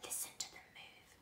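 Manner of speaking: hushed breathy whisper, no voice; old man hushing James over the bag, whispering. Acted. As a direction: whisper it like a secret. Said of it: "the old man whispered".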